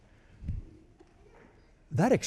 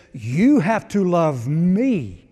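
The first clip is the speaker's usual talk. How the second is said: loud and slow, each word weighed; slow emphatic quote; 7 dB louder, slower than usual. Acted. Preacher quoting Jesus to church, slow and loud. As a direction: lay each word down slowly.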